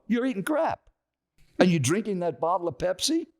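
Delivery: high-pitched mock indignation, not shouted; retold scolding. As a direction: imitate a stranger denouncing the food. Acted stranger scolding his food.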